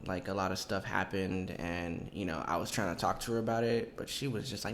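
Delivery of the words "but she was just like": normal talking voice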